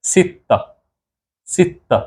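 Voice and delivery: Arabic number six, high. Shown as a demonstration. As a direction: lean on the double t in सित्ता. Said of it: "छः को बोलते हैं".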